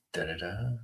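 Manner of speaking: soft, low sung-out jingle; after-dark voice. Pretend. Host rounds off the late-night intro, hushed after-dark voice. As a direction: murmur a little intro tune, low. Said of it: "your After Dark voice is amazing".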